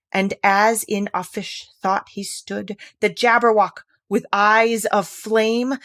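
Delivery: slow, grand, declaimed verse; dramatic poem recital. Acted. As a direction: recite it like an epic ballad.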